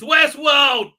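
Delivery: loud, high, drawn-out exclamation; excited shout. Real, not acted. Streamer naming a show he loves, excited shout. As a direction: announce it with gleeful force.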